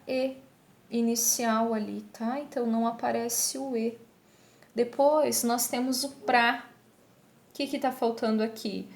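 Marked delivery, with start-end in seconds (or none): 6.1-6.7 "pra" in one syllable, first A dropped; shortened form. Shown as a demonstration. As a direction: say "pra", cut down from "para". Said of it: "eu tirei aqui um A". ("pra" sem o primeiro A)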